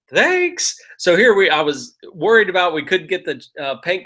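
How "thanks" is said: thanks squeaked up high; a delighted little squeak of thanks; high, pleased mock thanks; surprised thanks. Excited thanks for surprise lead.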